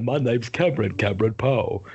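Cage's hammed-up Alabama accent in Con Air. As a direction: put on a deep Alabama accent like Cage. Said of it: "His Alabama accent".

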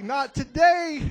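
slow, loud, firm refusal; defiant warning, measured. Retold or imitated. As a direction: shut it down flatly and loud.

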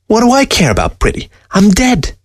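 high, scoffing disdain; scornful. A narrator's reading. Fox scoffing at the old man's preservative. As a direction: toss it off with contempt.

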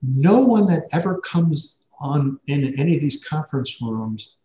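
a hint of a baffled office assistant; puzzled receptionist. Scheduler voiced in retold story.

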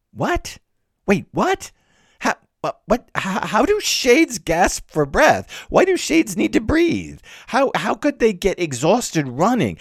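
high, stammering incredulity; baffled disbelief. Acted. Reader stumped by shades gasping for breath, incredulous. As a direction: sputter high-pitched questions, completely thrown. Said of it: "three what moments in which I, as a reader, go, What".

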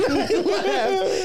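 high slow laugh. Real, not acted. Ending the story for the friend, laughing.

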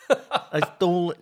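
Christopher Walken impression. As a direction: breaks into a laugh mid-impression. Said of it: "Can you say, "I stole a heat gun"?".

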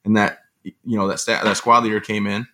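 ordinary talk

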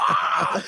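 imitated commentator's wild scream; short scream of game-winning excitement; excited mock announcer "Ah!"; sportscast scream. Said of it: "The way he told that story".

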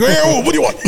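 high-pitched mock startled reply. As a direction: blurt it as if caught off guard, laughing. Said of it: "startled too".

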